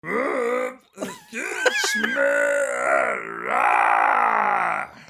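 dinosaur voice. Pretend. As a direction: growl like a dinosaur star. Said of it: "The Rock as a dinosaur".

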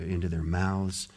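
ordinary talk

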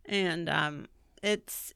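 ordinary delivery

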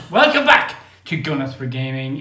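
ordinary talk

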